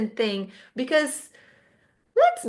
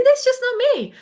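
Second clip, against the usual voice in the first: much higher pitch, exaggerated; high-pitched mock protest; 13 semitones higher, 4 dB louder than usual. Shown as a demonstration. Teacher showing it's just not her, in a high exaggerated voice. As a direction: jump way up in pitch. Mocks her own put-on accent.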